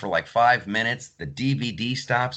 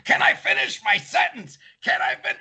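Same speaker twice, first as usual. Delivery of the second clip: mock yell; 14 semitones higher than usual. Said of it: "like, yelling".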